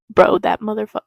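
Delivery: real jumpy outburst at a wasp; loud startled curse, cut off; startled yelp. Real, not acted.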